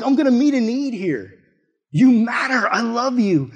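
screaming out. Acted. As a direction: proclaim it loudly to the whole room. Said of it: "so he's screaming out".

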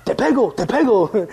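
high, puffed-up playful threat; imitating Ricky. Ricky bows up back at the little boy, mock threat. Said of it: "He bows up and he's like".

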